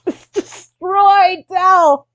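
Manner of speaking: laughing exasperated yell. Acted. Boss character complaining about paperwork.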